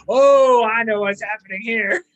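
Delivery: loud "oh" of recognition, then easing off; knowing exclamation. Retold or imitated. Host recalls spotting the coming plot, knowing exclamation. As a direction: burst out with sudden recognition.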